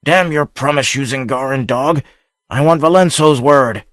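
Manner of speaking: angry shout. A narrator's reading. Pirate defying across the gate.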